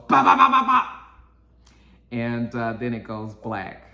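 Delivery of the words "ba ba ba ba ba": loud, high rapid-fire vocal gunshots; staccato mouth-made gun bursts; imitated gunfire. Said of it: "somebody just goes".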